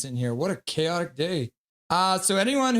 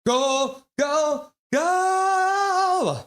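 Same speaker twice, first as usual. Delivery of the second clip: building cheer; 11 semitones higher, 5 dB louder, much slower than usual. Retold or imitated. Streamer voicing chat, chant climbing to a yell. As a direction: go chant rising to a high, loud yell. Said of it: "Brick Freddy's like".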